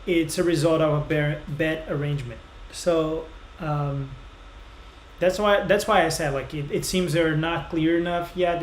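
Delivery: ordinary talk, nothing marked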